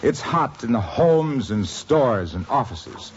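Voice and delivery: ordinary talk, nothing marked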